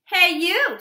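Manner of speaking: loud, high challenge; grouchy ladybug. Acted. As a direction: shout a bold dare. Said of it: "said the grouchy ladybug".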